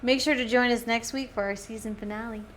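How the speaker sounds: bright show sign-off; upbeat host outro. Acted. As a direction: wrap the episode up like a host. Signing off the podcast episode.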